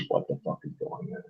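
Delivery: breathy whispered what-the-hell; whispered bewilderment. Retold or imitated. Whispering the party's confusion at the scene. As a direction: hush it out, baffled. Players' bewildered reaction voiced.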